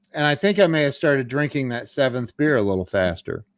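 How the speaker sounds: ordinary talk, nothing marked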